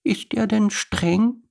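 very high, slow small voice; tiny high-pitched child question; timid little cub. Acted. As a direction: ask it carefully as a little girl. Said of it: "fragte Lisa".

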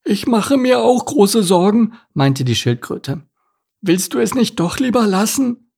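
put-on a nervous old turtle pleading gently; worried turtle. Turtle Larissa speaks.

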